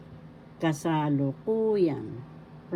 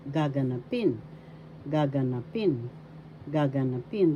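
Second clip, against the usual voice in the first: gaganapin repeated, each accented on final PIN; stress on fourth syllable. Shown as a demonstration. Teacher repeating for learners, gaganapin stressed on PIN. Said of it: "Fourth syllable has the accent".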